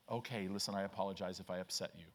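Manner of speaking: low, quick, placating apology; acted soft apology. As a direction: back off softly and quickly. Acted apology to upset stranger.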